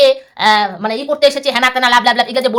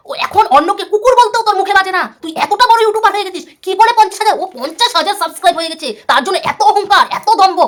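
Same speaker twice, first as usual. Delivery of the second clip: higher, heated, mocking his pride; angry tirade; 6 semitones higher than usual. Real, not acted. Vlogger berating rival for arrogance, heated and higher.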